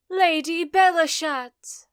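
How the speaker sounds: high, slow grand exclamation; exclaimed. Acted. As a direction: announce it proudly. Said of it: "Ayama exclaimed".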